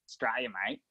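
low, quick sample of Australian twang; broad Aussie accent. Shown as a demonstration. Co-host giving an example word, broad Australian accent. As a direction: toss it off in thick Aussie. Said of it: "like, some words".

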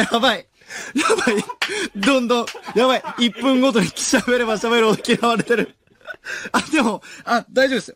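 high, loud voice full of laughter; flustered laughing. Real, not acted. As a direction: talk through a real laughing panic. Flustered, laughing at being disliked.

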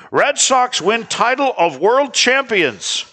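put-on slow, high announcer read of an old headline. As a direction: proclaim it like a newsreel. Reading old headline aloud.

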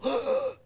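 loud, high demonstration gasp; sharp gasp. Shown as a demonstration. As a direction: gasp in a big breath, chest heaving. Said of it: "If he were to go".

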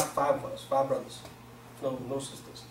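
ordinary delivery

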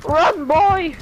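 high, loud frantic yelling; frantic shout. Real, not acted. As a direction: yell at him to get away. Shouting at teammate to flee.

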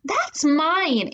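high possessive cry; little sister screaming. A narrator's reading. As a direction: scream like a child guarding her things. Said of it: "Angelina screamed".

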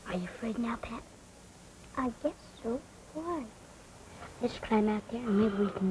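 put-on scared child whisper. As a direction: whisper it, afraid of being heard. Children hiding in the dark.

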